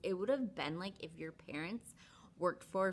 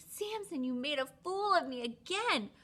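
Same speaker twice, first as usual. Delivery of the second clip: higher exasperated whine; whiny Delilah; 9 semitones higher than usual. Retold or imitated. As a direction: complain like a sulky Delilah. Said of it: "and she's like".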